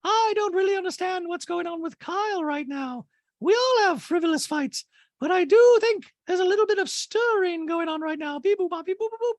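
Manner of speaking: very high, exaggerated mock voice; hammed-up high voice, running into robotic beeps; Dorit impression. Dorit weighing in on Kyle's fights, high caricature. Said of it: "So, and Dorit and I are talking".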